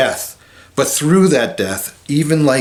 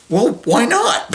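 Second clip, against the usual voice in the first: breezy optimism; 10 semitones higher than usual. Retold or imitated. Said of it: "very optimistic, uh, spiritual type person".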